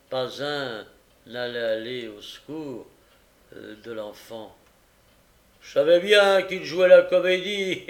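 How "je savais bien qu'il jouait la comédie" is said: loud, high crowing; gloating magistrate, voice raised; scornful triumph. A narrator's reading. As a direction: crow that you knew it all along. Magistrate triumphantly scorns fainted boy.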